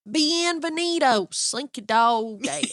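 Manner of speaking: slow country drawl on Spanish; Spanish in country accent. Retold or imitated. Showing how the ride says it in Spanish, drawn-out country accent. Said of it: "still in a country accent".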